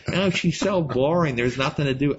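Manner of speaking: mock-complain like a bored teenager; whiny teen. Younger brother mocking his own old whining about Grandma, high whine.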